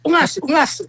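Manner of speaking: a pretend jab at a rival; mock insult. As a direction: toss off the insult with relish. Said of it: "kung sabihin ko".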